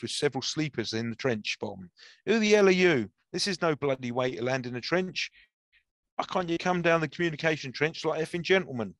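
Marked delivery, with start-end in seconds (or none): 2.3-8.9 a rude awakening, lashing out; angry outcry. A narrator's reading. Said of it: "an angry outcry burst".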